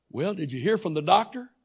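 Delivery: luid, hoog, gespeeld onschuldig; feigned innocence. Retold or imitated. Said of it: "That wasn't very good, that wasn't nice".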